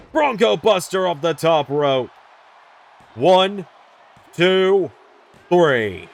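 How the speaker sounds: excited shouted pin count; wrestling commentator. Real, not acted. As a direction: call the three count loud and high. Pinfall count at match end.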